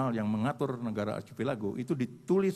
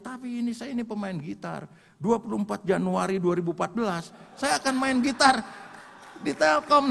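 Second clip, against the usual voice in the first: high-pitched, building to loud proclamation; insistent protest; 6 semitones higher, 4 dB louder than usual. Retold or imitated. Injured guitarist tells comrade about coming show, insistent. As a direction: start pitched up, swell into an emphatic declaration.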